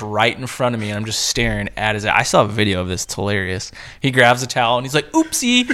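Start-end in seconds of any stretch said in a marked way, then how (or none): 5.1-5.7 squeaky high little oopsie; high mock oopsie. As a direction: say it high and coy. Mike grabs towel, says oopsie.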